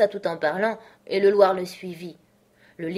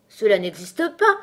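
louder, indignant objection; angry outcry; 3 semitones higher, 7 dB louder than usual. A narrator's reading. Alice disputing the treacle well, angry outcry. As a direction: cry out crossly. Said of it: "s'écria Alice avec colère".

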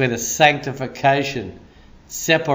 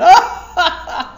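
high, loud burst of laughter; uncontrollable laugh; 13 semitones higher, 6 dB louder than usual. Retold or imitated. Preacher shows how he laughs making tea, big high laugh. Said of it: "I can't stop laughing".

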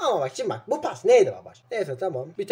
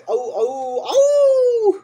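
crying out au; 11 semitones higher, much slower than usual. Real, not acted. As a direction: yelp it out in mock pain. New signing moment, crying out. (çok tizleşen, yüksek sesli bir acı çığlığı)

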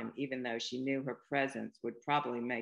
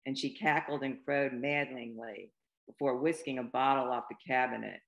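ordinary delivery

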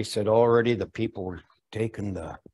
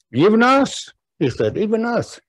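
incredulous; 12 semitones higher, 6 dB louder than usual. Acted. Brother marvels at rejection.

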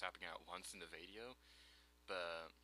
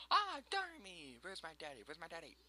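put-on child voice; 10 semitones higher, 6 dB louder than usual. Voicing baby Goron crying out.

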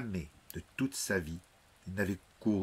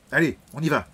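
loud, higher command; sudden order; 6 semitones higher, 10 dB louder, faster than usual. Acted. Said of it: "ordonna soudain Jonah". (ordre soudain et fort)

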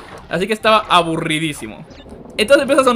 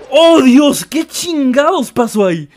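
high, loud, drawn-out disbelief; shocked yelling; 8 semitones higher, 7 dB louder, slower than usual. Real, not acted. Streamer stunned by what just happened in game, loud cry. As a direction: cry out, stretching the words.

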